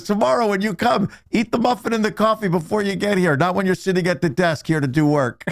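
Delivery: high-pitched indignant boss impression; retold scolding boss. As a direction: lay down the rule, imitating an exasperated boss. Boss lectures new hire.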